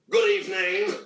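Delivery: father's anchorman voice. Retold or imitated. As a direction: imitate dad's golden-throated anchorman delivery. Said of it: "my father talked like this".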